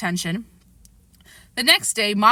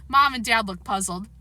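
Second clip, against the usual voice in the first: ordinary delivery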